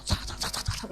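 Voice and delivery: unvoiced sat sat of quick running steps; whispered rushing noise. Preacher mimics waria rushing for money, whispered sat sat.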